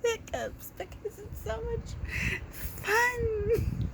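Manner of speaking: high, slow laughing words; words squeezed out through laughter; real helpless laughter. Real, not acted.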